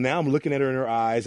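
usual speaking voice

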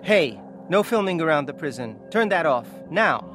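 firm, irritated order; annoyed bodyguard. Acted. Bodyguard forbidding filming at the prison, annoyed. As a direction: order them off with growing force. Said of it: "Mister Bodyguard looks annoyed".

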